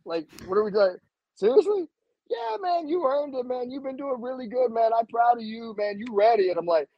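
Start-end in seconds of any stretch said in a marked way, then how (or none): 0.1-1.9 disbelief. Retold or imitated. Disbelief at promotion. 2.3-6.5 much higher friendly instructor voice; imitating proud instructor. Instructor praising him.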